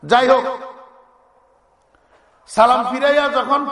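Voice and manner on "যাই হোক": normal talking voice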